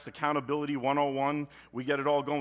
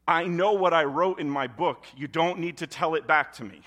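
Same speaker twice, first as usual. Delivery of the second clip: louder, exasperated God voice; God cutting off a silly party, louder; 3 semitones higher, 7 dB louder than usual. Acted. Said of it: "And God's like".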